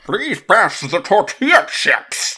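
high, loud, slow Bane impression. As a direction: ham up Bane asking for chips. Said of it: "does that voice".

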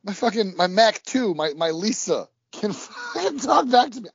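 high excited mock writer. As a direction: ham up a giddy eighties screenwriter. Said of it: "They're like".

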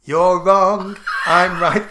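ordinary delivery

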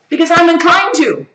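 high, loud mock admission; exclaimed confession. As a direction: blurt it out high and loud as the sinner. Answers own question as the sinner.

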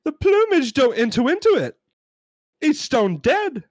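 loud, high, exasperated British customer; hammed-up British outrage; British accent. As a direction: insist loudly in a put-on British accent. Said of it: "We're gonna try our British accents".

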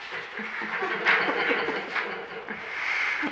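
a soft unvoiced laugh; breathy laugh. Real, not acted. After the scene ends.

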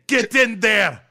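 shouted in a hammed-up Scottish accent, high and loud; hammed-up Scottish yell; mock Scottish accent. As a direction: bark it like an angry Scot. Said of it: "You're getting so much better at that".

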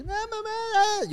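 high, slow wailing plea; mock crying. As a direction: wail it out like a drunk kid sobbing.

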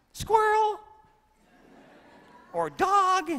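sudden high, loud excited call; sudden excited cry. Pretend. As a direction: blurt it like a dog spotting a squirrel. Dog suddenly spots a squirrel.

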